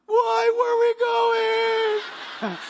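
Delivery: put-on high, loud, drawn-out child voice; be a thrilled, surprised little kid; excited kid shout. Child shouting at dad on the way to the car, high and excited. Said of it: "Get in the car".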